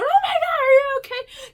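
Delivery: high alarmed friend's voice; Karen alarmed. Retold or imitated. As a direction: squeal like a frightened friend. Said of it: "Karen's going".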